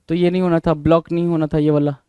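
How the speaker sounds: ordinary talk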